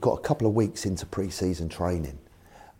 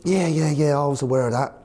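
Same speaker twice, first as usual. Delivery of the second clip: louder, higher quick-fire bluff; bluffing reassurance; 7 semitones higher, 5 dB louder than usual. Retold or imitated. Manager bluffs his chairman about the ban, bluffing reassurance. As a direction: brush it off as if fully informed.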